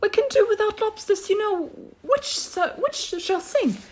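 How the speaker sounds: high creature voice, starting loud; high turtle consulting a partner; Mock Turtle. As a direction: ask it in a high-pitched turtle voice. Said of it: "said the Mock Turtle".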